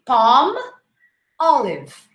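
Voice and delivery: Palm, a clear gap, then olive; separated word. Shown as a demonstration. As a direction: split Palm olive in two. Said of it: "separate the word here".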